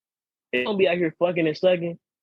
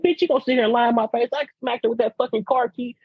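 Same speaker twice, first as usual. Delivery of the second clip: higher, fast, heated yell; angry confrontation; 10 semitones higher than usual. Retold or imitated. Confronting a cheating girlfriend, fast heated yell. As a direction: jump on her for lying. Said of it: "I said".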